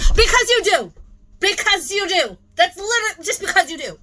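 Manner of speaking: high, loud mocking whine. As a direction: sneer it back at her. Mocking seller's excuse.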